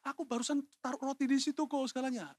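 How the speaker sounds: high-pitched, put-on self-defence; protesting younger brother. Younger brother proving his innocence, high protesting voice. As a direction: insist on your proof in a high voice. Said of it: "Kalau saya jadi Daud, saya akan mencoba membuktikan".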